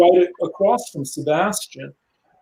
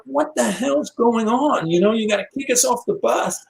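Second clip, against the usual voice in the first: angry outburst; 7 semitones higher than usual. Retold or imitated. Sebastian angry at driver.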